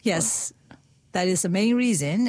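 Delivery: normal talking voice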